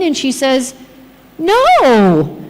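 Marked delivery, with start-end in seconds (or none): 1.4-2.4 high, drawn-out "no" sliding down; reluctant admission. Retold or imitated. Patient admits to carer she has no pain, reluctant. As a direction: mimic a patient owning up slowly.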